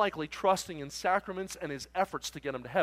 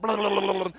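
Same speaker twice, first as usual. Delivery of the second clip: loud rattling engine noise; high, loud motor imitation; imitated diesel engine; 4 semitones higher, 7 dB louder than usual. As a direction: rumble like a diesel truck pulling up. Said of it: "I hear this other diesel pull up".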